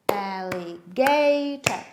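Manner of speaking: slow, loud alligator with GA drawn out; stress on GA. Shown as a demonstration. Teacher leading children clapping all together, stress on GA. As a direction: beat out alligator, hardest on GA. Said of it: "you make it high".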